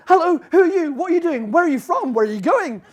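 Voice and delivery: high, loud, eager questions rattled off; excited questions. Retold or imitated. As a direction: pounce on her with questions. Pestering a lone walker.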